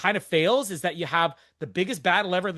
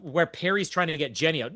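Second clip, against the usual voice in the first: ordinary talk, nothing marked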